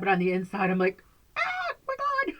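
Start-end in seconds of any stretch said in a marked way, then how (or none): 1.4-2.3 high, squealed mock freak-out; mock inner panic. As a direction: squeal it like anxiety let loose inside. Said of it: "I have crippling anxiety".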